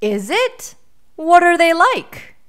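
loud question rising high; intrigued bear. A narrator's reading. Bear asks the boy about the beasts, intrigued. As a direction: ask with growing storybook astonishment.

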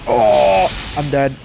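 ordinary delivery

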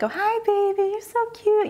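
put-on high-pitched doggy baby talk. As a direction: coo at a cute little dog. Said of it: "my voice kinda changes".

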